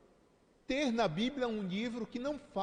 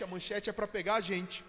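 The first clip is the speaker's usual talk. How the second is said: normal talking voice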